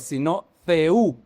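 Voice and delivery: Theú said slowly, stressing the ú; slow careful Theú; Greek genitive. Shown as a demonstration. Teacher showing congregation the Greek genitive, slow Theú. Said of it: "ya no se dice Theos".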